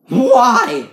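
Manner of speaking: long, high, loud yell; over-the-top exasperated scream; furious outburst. A narrator's reading. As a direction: howl it as if about to burst. Enraged man demands explanation.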